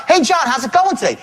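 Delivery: high, upbeat sales greeting; pumped-up phone opener; popping out. Shown as a demonstration. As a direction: greet a prospect to lift their state. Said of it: "you're gonna pop out".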